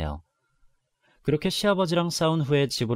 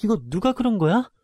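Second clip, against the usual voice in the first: higher, louder upset question; alarmed demand; 7 semitones higher, 4 dB louder than usual. Acted. Daughter asking her father who broke the stall, upset.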